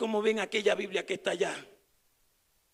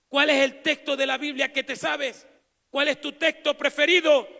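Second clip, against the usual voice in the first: loud exhortation; 2 semitones higher, 7 dB louder than usual. Acted. Exhorting congregation.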